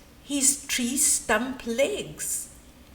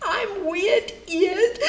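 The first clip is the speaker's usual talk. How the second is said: slow, high, crying wail; 7 semitones higher, 4 dB louder, much slower than usual. A narrator's reading. Storyteller voicing Fragility as she wails. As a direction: wail it out, half laughing. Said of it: "wailed Fragility".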